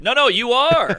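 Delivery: high-pitched, emphatic correction; insistent heckler. Retold or imitated. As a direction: insist like a heckler scoring a point.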